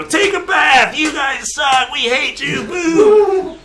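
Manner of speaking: high shouted heckling; jeering crowd. Acted. Gnome crowd heckling the ugly wrestlers, high shouted jeers. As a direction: yell insults like a rowdy crowd. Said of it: "And all the crowd is like".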